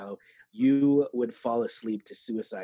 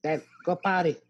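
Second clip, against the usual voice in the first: put-on bossy kid; 8 semitones higher, 4 dB louder than usual. Mocking the kids' bossy tone.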